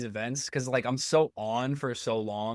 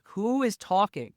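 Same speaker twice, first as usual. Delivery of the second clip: confused; 8 semitones higher, slower than usual. Retold or imitated. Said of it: "So I'm like".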